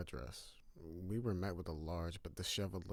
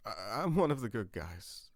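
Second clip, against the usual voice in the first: teary tone; 3 semitones higher, 6 dB louder than usual. Acted. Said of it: "I said in my teary tone".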